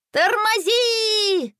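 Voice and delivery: very high, long drawn-out yell; frantic, high-pitched call to stop; desperate cry. A narrator's reading. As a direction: wail it desperately, high and stretched. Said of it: "отчаянно закричал Рома".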